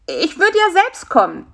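high, loud, small fly's voice; high, pleading insect; whiny little fly. A narrator's reading. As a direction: protest in a tiny, high-pitched voice.

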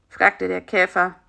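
plain reading voice

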